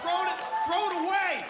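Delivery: high, loud yell; shouted order. Acted. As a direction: bark it at the parents. Yelling at idiot parents.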